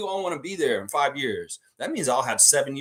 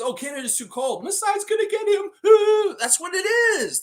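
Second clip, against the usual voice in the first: high, put-on mocking voice, much higher; mocking falsetto whine; 5 dB louder than usual. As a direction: squeal it like a whiny critic, with a loud "Ooh". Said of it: "It materializes".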